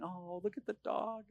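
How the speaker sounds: cooing at a puppy. Retold or imitated. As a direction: coo lightly at the cute dog.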